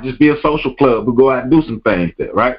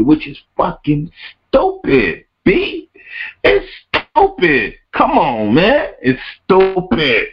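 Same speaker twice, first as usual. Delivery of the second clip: slow, higher drawled stupid; mocking exasperation; drawn out disgust; 7 semitones higher, slower than usual.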